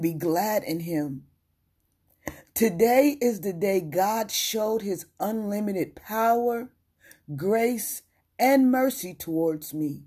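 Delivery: loud, fervent preaching. Acted. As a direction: proclaim it to the congregation. Preaches with rising force.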